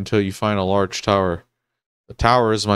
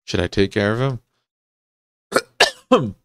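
ordinary delivery

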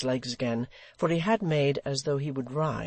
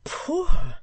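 very high, loud exclamation; sudden high-pitched outburst; cried out; 10 dB louder than usual. A narrator's reading. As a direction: cry it out in disbelief. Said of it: "she cried".

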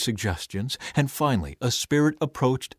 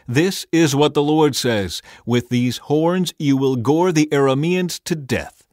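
raised, higher-pitched prophetic proclamation; proclaiming; 4 semitones higher, 5 dB louder than usual. A narrator's reading. Prophet announcing the Lord's word to the kings, proclaiming. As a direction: proclaim it with ringing certainty. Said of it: "made some iron horns and proclaimed".

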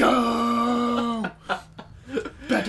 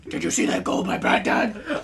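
raised, rasping proud question; Bat Dad; 4 semitones higher than usual. Acted. Friend fishes for praise after goal, Bat Dad voice. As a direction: ask proudly in hero rasp. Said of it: "I was doing Bat Dad".

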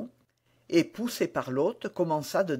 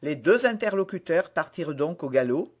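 ordinary delivery, nothing marked

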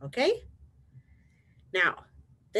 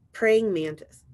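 ordinary delivery, nothing marked

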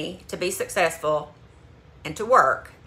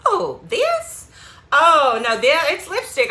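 surprised; 7 semitones higher, 6 dB louder, slower than usual. Retold or imitated. Surprised reply to clerk.

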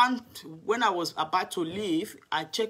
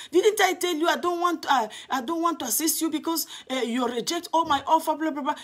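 high, louder mimic of an annoyed manager; irritated manager; 8 semitones higher, 4 dB louder than usual. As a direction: scold her for coming back, voice up high. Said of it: "He said".

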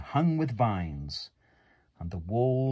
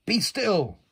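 higher, louder firm command; commanding Max; 10 semitones higher, 6 dB louder than usual. Acted. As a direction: order the monsters to freeze. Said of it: "till Max said".

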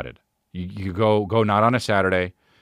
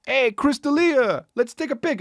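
fan calling out. Retold or imitated. People in line calling out to the comedian, high and eager. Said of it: "people in the line were like".

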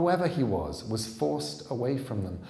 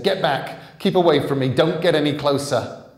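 loud, higher frightened yelling; cornered man shouting in panic; panicked shout; 5 semitones higher, 12 dB louder than usual. Acted. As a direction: shout to hold the creature off. Man Jack cornered by monster.

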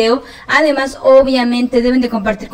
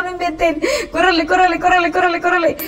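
panicked; 6 semitones higher than usual. Real, not acted. Panicked fleeing in game.

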